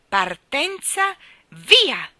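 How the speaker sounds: loud starting call, Partenza slow and high; shouted race start. Acted. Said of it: "gridò".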